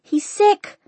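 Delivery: very high, exasperated retort; shouting back. A narrator's reading. Daughter shouts back at her father.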